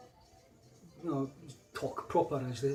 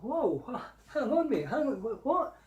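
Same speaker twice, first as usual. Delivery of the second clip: much higher, stammering disbelief; startled protest; 10 semitones higher than usual. Retold or imitated. Customer reacting to the shopkeeper's insult, with high startled disbelief. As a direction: caught off guard, hang on what.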